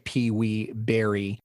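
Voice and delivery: normal talking voice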